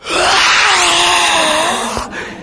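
long high-pitched sobbing wail; imitated bawling wail. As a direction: burst out bawling, acted out. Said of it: "Bawled harder than you can even imagine".

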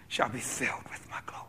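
breathy awed whisper of the promise; hushed whisper. Acted. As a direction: let God's promise fall to a whisper. God's promise ends hushed.

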